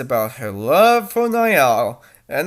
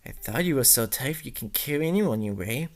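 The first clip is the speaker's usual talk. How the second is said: ordinary delivery, nothing marked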